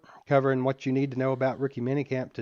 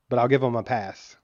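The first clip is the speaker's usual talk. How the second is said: normal talking voice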